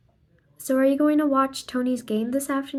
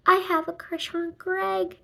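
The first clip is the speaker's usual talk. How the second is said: lightly voiced girl, pitched higher, confiding; a hint of a giddy teen telling her secret; gushing schoolgirl; 7 semitones higher than usual. Girl confides to her friend about Greg, higher gushing voice.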